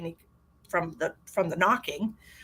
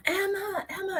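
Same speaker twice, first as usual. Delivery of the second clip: high called name, repeated; retold yelling; 12 semitones higher, 4 dB quieter than usual. Parent searching, yelling a daughter's name. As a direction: call her name into the house. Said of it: "so I'm yelling her name".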